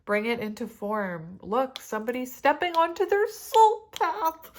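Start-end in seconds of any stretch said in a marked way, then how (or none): 1.4-4.5 much higher, much louder joyful squeal; real excited cry; overjoyed outburst. Real, not acted. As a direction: burst out with delight at the card.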